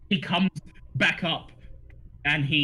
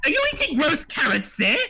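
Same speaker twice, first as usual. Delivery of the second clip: alchemist gnome voice; 11 semitones higher than usual. Acted.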